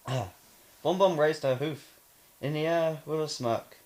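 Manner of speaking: usual speaking voice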